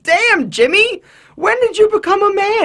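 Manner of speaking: squeaky high surprised voice; high-pitched amazed. Retold or imitated. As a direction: squeak it out in awe. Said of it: "And you're like".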